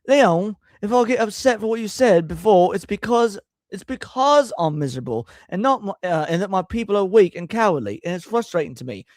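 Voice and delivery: a hint of a British [name] accent; [name]-esque British accent. Shown as a demonstration. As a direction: read it like a Beatle, lightly put on. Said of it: "that was a semi-[name]-esque accent".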